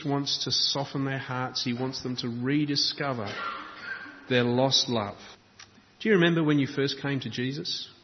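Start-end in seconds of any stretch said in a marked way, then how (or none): none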